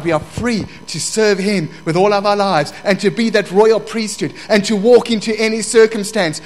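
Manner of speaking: high, loud, building declarations; rousing preaching. Real, not acted. Preaches freedom in Christ.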